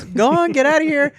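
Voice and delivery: teasing teacher. Retold or imitated. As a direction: shoo the student out with mock sternness. Teacher teasing stoned student.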